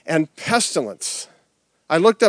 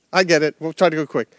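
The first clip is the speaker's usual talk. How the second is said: ordinary talk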